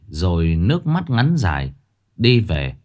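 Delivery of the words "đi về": ordinary delivery, nothing marked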